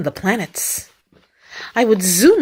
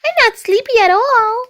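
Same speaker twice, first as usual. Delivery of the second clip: put-on very high, protesting child voice; little Bunny; 14 semitones higher, 4 dB louder than usual. A narrator's reading. Youngest rabbit insists to mommy, high-pitched protest. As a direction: pipe up like a stubborn bunny child. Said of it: "said little Bunny".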